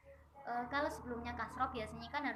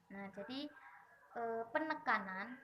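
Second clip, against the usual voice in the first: ordinary talk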